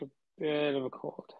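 ordinary talk, nothing marked

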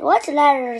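loud, curt comeback; snapped retort. A narrator's reading. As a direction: snap back at a parent. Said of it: "snapped Henry".

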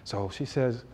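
usual speaking voice

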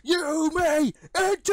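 high, drawn-out bellow; amplified shout. Acted. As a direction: holler permission slowly and very loudly. Amplified guard grants entry.

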